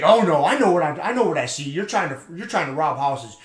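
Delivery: worked-up, insistent mock accusation; retold insistence. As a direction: imitate a neighbor doubling down on his accusation.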